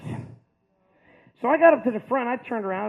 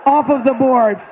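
much louder, slowed triumphant payoff; big finish; 2 semitones higher, 10 dB louder, slower than usual. Real, not acted. Comedian delivering the punchline to the crowd, loud triumphant. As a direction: land the punchline loud and slow.

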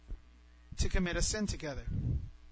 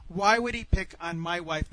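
ordinary talk, nothing marked

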